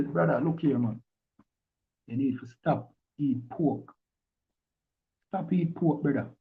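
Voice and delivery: soft, measured brotherly appeal; quiet counsel. Acted. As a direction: quote a brother's advice softly, unhurried. Acting out brothers giving advice.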